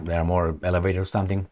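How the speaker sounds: ordinary delivery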